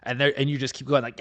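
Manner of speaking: ordinary talk, nothing marked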